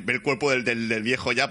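ordinary delivery

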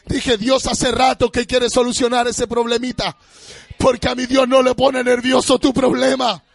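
high, loud, half-sung preaching over music; full-force preaching. Acted. Pastor rousing the congregation over the music, shouted and sung. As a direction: shout it to the rafters.

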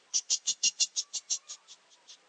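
unvoiced shushing ch ch ch; calming the lions with a shush; hushing lions. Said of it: "les dijo".

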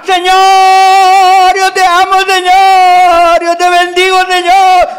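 high, loud, full-voice rancho prayer cry; shouted prayer. Retold or imitated. Preacher imitates old village church praying loudly. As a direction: cry out to the Lord at the top of your lungs. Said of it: "allá en mi rancho oraban".

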